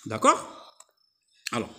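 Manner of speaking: ordinary talk, nothing marked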